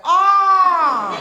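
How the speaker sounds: loud, high ecstatic "Ah!"; eyes rolling back with pleasure; showing the good ah, loud and high. Retold or imitated. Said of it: "The good ah is like your eyes are rolling back".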